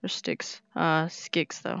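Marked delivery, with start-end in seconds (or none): none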